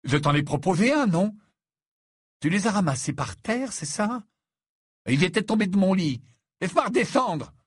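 high, loud, indignant complaint; agitated protest. A narrator's reading. Ron, dangling upside down, argues with Harry, agitated.

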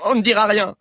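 high, loud defiance; defiant vow. Acted. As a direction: hurl it at the witch. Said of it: "jura Valère". (défi crié, aigu et fort)

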